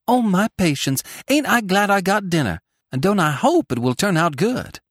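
exclaiming. A narrator's reading. Tilly is glad she got dinner, eager exclaiming. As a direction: exclaim with rising delight. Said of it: "exclaimed Tilly".

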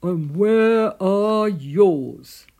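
very loud, slow demand; big drawn-out badger bellow; snorting Badger. A narrator's reading. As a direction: boom the question out, drawing out each word. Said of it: "Badger snorted".

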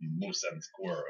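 softly put-on Russian accent. Answering the Bullwinkle bit, hammy Russian accent. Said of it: "that's the best Russian accent I can put on".

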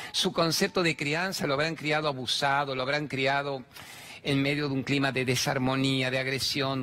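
ordinary talk

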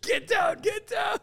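high, loud barked orders; mock raid shout. Comedian plays ice agents at a raid, shouting orders. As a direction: yell it like an agent bursting in.

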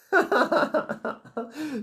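high-pitched mock villain giggle. Smiling man appears above her, gleeful giggle. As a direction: giggle like a creepy cartoon villain.

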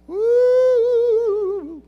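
high, loud whoop to rouse the crowd; a long high woo to wake the room; woo shout. Real, not acted. Preacher whoops to get the congregation talking, loud and high. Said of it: "That was just to try to get, get you all to talk".

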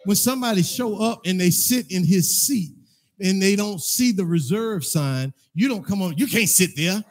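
climbing to a loud, high preacher's call; full-force preaching. Acted. Pastor scolding church staff, preaching loud and high.

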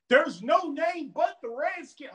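high, drawn-out mock protest; mocking die-hard fan. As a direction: imitate a stubborn fan in a slow, high voice. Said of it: "I'm not gonna ever be that".

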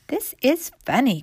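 high, mildly surprised exclamation; puzzled cry. A narrator's reading. Mistress finding the dolls moved, surprised cry. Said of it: "cried the mistress".

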